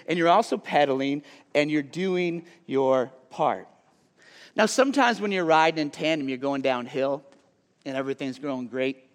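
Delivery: normal talking voice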